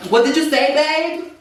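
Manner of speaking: put-on high, camped-up girlish voice; imitated girlish man. Man at Walmart asking, mock girlish and high. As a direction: pitch it way up, mock-feminine. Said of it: "the man was like".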